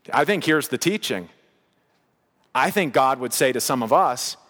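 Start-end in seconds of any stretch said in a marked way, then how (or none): none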